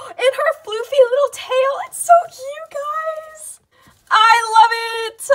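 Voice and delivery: very high, loud squealing over a fluffy tail; delighted squeal. Real, not acted. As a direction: let it burst out in a high squeal of joy.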